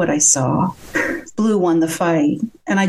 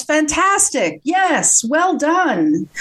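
high, excited mock cheer; cheering coach; 8 semitones higher, much slower than usual. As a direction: cheer on your fighter like a ringside coach. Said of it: "his coach cheers, shouts".

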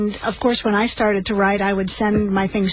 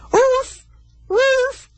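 high yelping woof; frantic dog bark; 3 dB louder than usual. Acted. Lost dog barking in the empty house, high frantic yelps. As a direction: bark like a panicked little dog. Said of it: "called Fritzy frantically".

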